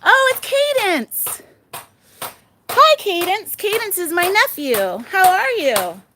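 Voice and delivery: high, happy surprise hello; delighted greeting. Real, not acted. Aunt spots her nephew online, high delighted greeting. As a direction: pitch it way up for a favourite nephew.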